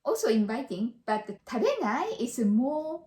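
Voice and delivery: ordinary talk, nothing marked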